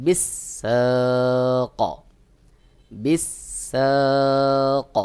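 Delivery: বিসাক drawn out very slowly, the final ক bounced with a hint of zabar; Quranic recitation demo. Shown as a demonstration. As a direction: recite বিসাক slowly, the ক echoing open-mouthed. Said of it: "যেমন". (বিসাক ধীরে, শেষের ক-তে জবরের আভাসে কলকলা)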